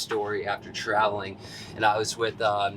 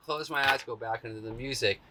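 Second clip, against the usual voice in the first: ordinary talk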